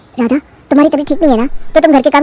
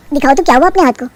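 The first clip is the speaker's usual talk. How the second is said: plain reading voice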